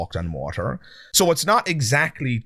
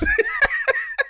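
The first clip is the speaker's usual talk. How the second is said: amused laugh. Real, not acted. Host laughs before playing the clip.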